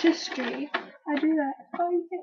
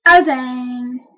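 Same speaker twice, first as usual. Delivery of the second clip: oh dang with a louder oh; 2 semitones lower, 8 dB louder than usual. Shown as a demonstration. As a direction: push out the oh.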